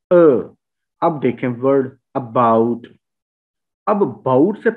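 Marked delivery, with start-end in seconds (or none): 0.1-0.5 a short, louder अ; just the neutral uh of अ; schwa sound. Shown as a demonstration. Teacher isolates the schwa sound. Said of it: "ये हकीकत में श्वा है".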